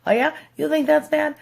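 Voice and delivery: mock the one-upper, climbing in pitch and volume; competitive one-upping. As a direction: narcissist topping your bad story, mock indignant. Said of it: "they're like".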